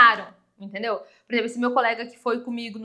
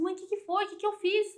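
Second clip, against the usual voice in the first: worried daughter; 7 semitones higher, faster than usual. Retold or imitated. Worried daughter questions her mother.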